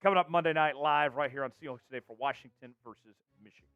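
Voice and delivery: energetic, emphatic sports host delivery; announcer show open. Acted. Host welcomes viewers to Seahawks show, energetic announcer. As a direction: punch key words like a show host.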